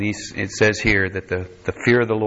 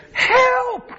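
a sudden, much higher cry of "Help!"; desperate cry for help; 3 dB louder than usual. Retold or imitated. Preacher voicing the people crying out to God, desperate. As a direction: call out like people out of options.

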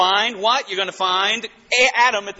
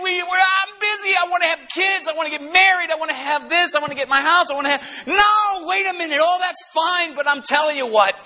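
preaching at full force; 9 semitones higher than usual. Real, not acted. Preacher shouting to his congregation about the joy of glory. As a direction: shout the glory of heaven to the flock.

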